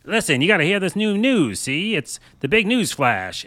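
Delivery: put-on old-timey newsreel voice. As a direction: announce it like a 1930s newsreel. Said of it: "It was like".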